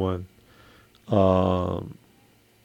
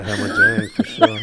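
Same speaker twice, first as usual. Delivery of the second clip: laughing. Real, not acted. Laughing at remembered slang.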